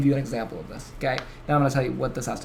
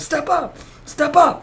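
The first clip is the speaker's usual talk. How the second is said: repeated rallying yell; 12 semitones higher, slower than usual. Retold or imitated. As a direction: keep pumping the crowd up. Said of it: "he says it like four or five times".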